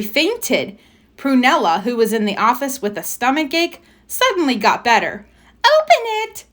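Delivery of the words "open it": loud high shout; a child shouting open it. A narrator's reading. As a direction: yell it out eagerly. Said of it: "she shouted".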